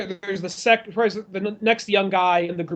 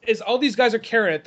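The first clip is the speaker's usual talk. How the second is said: ordinary talk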